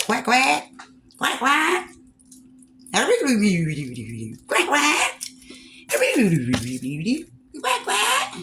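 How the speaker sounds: high squeaky duck quacking; duck quacks. Pretend. Duck noises for duck sauce.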